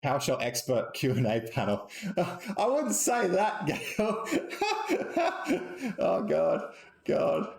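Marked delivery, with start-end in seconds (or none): 2.2-7.5 high laugh through the words, slowed right down; bashful laugh while brushing off praise. Real, not acted. Laughing it off as a co-host calls him a PowerShell expert. Laughing off a flattering panel title.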